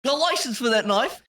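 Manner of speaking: high, loud British "license" jab; mock British copper. Game master playing a British cop, hammed-up accent. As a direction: do a stern London bobby, hammed up. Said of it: "all the little people you talk to are now British".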